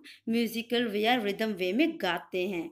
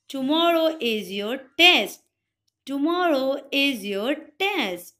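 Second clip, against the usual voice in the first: louder, slow announcement of the test; teacher announcement; 2 semitones higher, 5 dB louder, much slower than usual. Shown as a demonstration. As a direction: tell the class firmly. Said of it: "teacher आपको बोलते हैं". (ज़ोर से, धीरे घोषणा)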